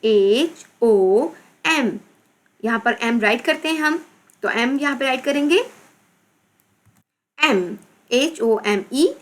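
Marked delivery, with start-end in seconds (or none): 0.0-1.9 slow, drawn-out letter names; spelling out. Shown as a demonstration. Teacher spelling out a word. 7.4-9.1 slow, drawn-out letter names; spelling out. Shown as a demonstration. Teacher spelling out a word.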